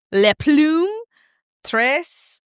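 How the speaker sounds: Le Plume said the French way; a quick French accent on the names. Pretend. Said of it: "sorry, the French in me".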